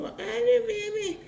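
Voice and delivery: put-on high, whiny child's voice; a kid whining back in high baby talk. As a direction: pout like a little kid.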